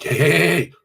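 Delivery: loud, high, teasing hey hey; playful. Retold or imitated. To angry girlfriend home late, loud playful teasing. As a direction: defuse her anger by playing around. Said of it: "Go into play".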